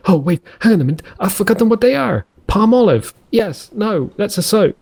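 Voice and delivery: high-pitched, mock-confused thinking aloud; flustered inner monologue. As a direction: act out your own muddled thoughts, pitched well up.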